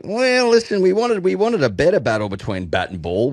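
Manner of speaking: high official media voice; Todd Greenberg. Retold or imitated. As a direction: put on a polite press-conference tone. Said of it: "spare a thought for Todd Greenberg ... being like".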